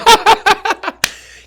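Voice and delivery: high, loud burst of laughter. Real, not acted. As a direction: crack up loudly. Laughs at the promotion outcome.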